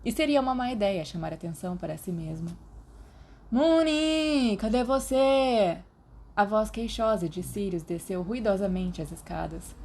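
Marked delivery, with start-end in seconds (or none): 3.5-5.8 high, loud call up the stairs, slow on cadê você; plaintive call across the house. Acted. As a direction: call for a friend from afar. Sirius calls down stairs.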